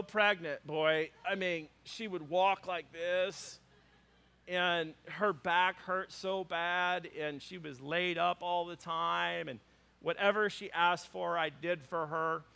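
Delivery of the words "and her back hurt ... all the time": ordinary talk, nothing marked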